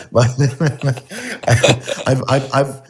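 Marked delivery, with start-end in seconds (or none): 0.3-2.0 a burst of high, embarrassed laughter; nervous giggle that breaks the sentence. Real, not acted. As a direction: laugh it off, pitched up.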